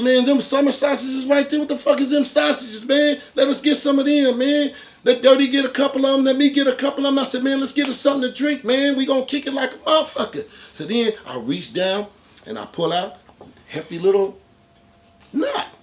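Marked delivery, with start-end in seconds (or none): 0.0-10.4 high, loud and brisk party talk; high, loud fake-buddy banter. In the liquor store, loud high buddy chatter. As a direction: act like his best friend, loud and quick. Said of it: "I was in Jeff mode".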